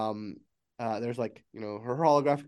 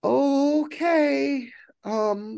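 very high, loud, drawn-out acceptance; acted strained attempt to cope; overwhelmed AI; 12 semitones higher, 5 dB louder, much slower than usual. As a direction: stretch it out high, barely coping. Overwhelmed AI takes in the news.

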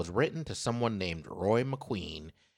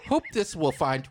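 loud, high mock-dramatic reading; dramatic emphasis; 8 semitones higher, 5 dB louder than usual. Shown as a demonstration. Host hams up the postcard reading for his co-host, loud. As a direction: read it like a grand proclamation. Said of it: "harmonica for emphasis".

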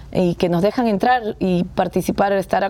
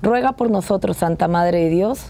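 steady devotional recitation; prayer recitation. Acted. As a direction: recite the response reverently. Prayer response.

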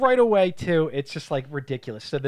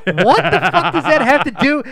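much higher and louder, laughing disbelief; baffled outburst; 11 semitones higher, 12 dB louder than usual. Retold or imitated. Mocking a viewer baffled by the movie, laughing loud outburst. Viewer reacting to absurd scene.

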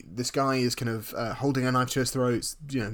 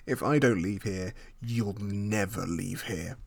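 villain threat; 4 semitones lower, slower than usual. Retold or imitated. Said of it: "To which the Tooch is like".

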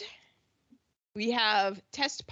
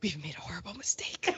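quick whispered confession; quoting the famous TV whisper; Arrested Development quote; 5 semitones lower, 7 dB quieter, faster than usual. Retold or imitated. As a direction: whisper it like a sitcom bit. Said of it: "he's doing the Job".

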